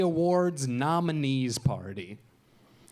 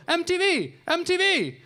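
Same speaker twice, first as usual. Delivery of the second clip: much higher, calling "M T V" eagerly; excited fans calling; 3 dB louder than usual. Retold or imitated. Fans on the boulevard calling to the comedian, high and eager. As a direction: shout it like a starstruck fan.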